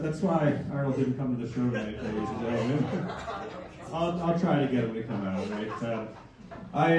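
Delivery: ordinary talk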